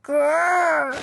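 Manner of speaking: playful mock dinosaur growl. [name] playing dinosaur at bedtime, mock growl.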